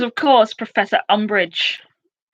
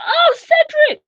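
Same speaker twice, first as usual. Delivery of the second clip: much higher sudden cry of recognition; remembering family member; 5 dB louder, slower than usual. Retold or imitated. Family suddenly remembering the name, high mock cry. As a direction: yelp the name as it finally comes back. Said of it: "suddenly, like".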